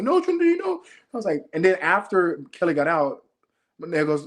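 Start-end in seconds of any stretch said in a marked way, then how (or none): 0.0-0.8 high-pitched imitated protest; acted refusal. Manel refusing to leave with Trinity, high imitated protest. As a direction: refuse in a high, pleading mock voice.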